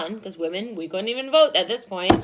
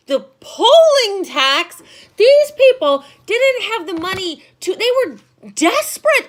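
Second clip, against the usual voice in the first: indignant; 7 semitones higher, 8 dB louder than usual. Real, not acted. Indignant at the poll tax.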